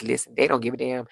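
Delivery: ordinary talk, nothing marked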